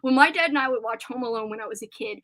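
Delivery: ordinary talk, nothing marked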